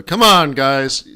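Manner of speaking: loud, fed-up plea; exasperated scolding. Real, not acted. Host loudly scolding viewers who didn't watch the video. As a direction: come on, throw up your hands at them.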